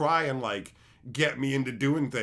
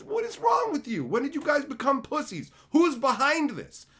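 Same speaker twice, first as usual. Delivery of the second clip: high, loud incredulous outburst; worked-up retold interrogation; outraged demand; 13 semitones higher, 5 dB louder than usual. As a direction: demand answers at a screechy pitch. Acted outrage at friends.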